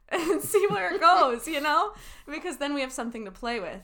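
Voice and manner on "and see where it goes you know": high, loud hammed-up fake crying with a laugh; mock crying. Shown as a demonstration. Improv teacher demonstrating to her colleague: pick an emotion, mock crying. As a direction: burst into pretend tears, half laughing. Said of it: "I'll just start crying".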